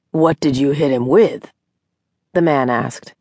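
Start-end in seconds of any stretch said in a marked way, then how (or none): 0.1-1.5 lightly voiced deep-voiced man; deep baritone. A narrator's reading. Doctor questioning Mary about the injured man, hint of baritone. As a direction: suggest a man's baritone. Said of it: "his voice a deep baritone".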